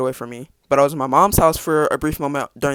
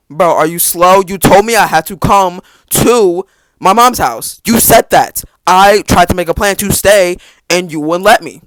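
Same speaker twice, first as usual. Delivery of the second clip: much louder and higher, venting hard; exasperated rant; 3 semitones higher, 8 dB louder than usual. Retold or imitated. Ranting at dad on mute, frustrated shout. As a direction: yell it while your dad can't hear you.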